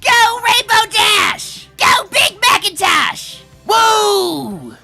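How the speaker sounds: high, loud fan cheer; whooping from the stands; cheering shout. Acted. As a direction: holler for your racers, stretched out.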